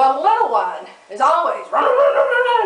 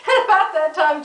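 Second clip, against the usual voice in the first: ordinary talk, nothing marked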